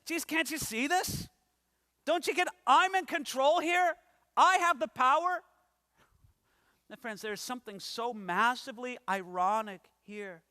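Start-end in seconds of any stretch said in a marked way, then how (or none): 0.1-5.4 higher, rising to louder on power; insistent Pilate. Acted. Preacher voicing Pilate insisting to Jesus he holds the power, rising insistence. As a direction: insist you're in control.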